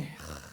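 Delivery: low, soft exasperated sigh; demonstrated sigh. Acted. Preacher acting out his displeasure at others, a soft deflated sigh. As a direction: let out a hushed sigh of lament. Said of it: "탄식하고 있고 한숨 푹푹 쉬고 있는데".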